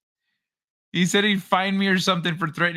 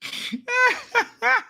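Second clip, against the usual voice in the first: laughs. Real, not acted. Cracking up at the story.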